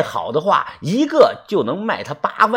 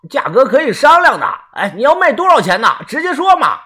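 high, raised voice through a door; loud, insistent calling; 8 semitones higher, 4 dB louder than usual. A narrator's reading. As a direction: call out urgently to keep the deal alive. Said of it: "拍门大声地说". (拍着门高声急喊，不依不饶)

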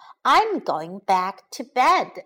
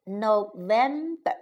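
teacher breaking "November" into its syllables; 3 dB quieter than usual. Shown as a demonstration. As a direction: sound out "November" one syllable at a time for a learner. Teacher models month syllable by syllable.

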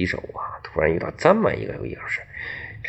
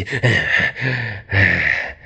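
panting. Acted. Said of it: "直喘气".

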